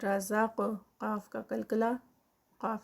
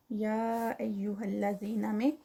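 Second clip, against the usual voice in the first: ordinary talk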